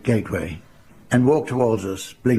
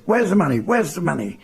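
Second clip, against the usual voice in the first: panicked demand; 6 semitones higher than usual. Retold or imitated. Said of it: "panic reigned".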